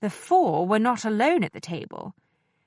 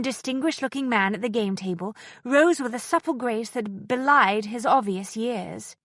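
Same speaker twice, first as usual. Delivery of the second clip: ordinary delivery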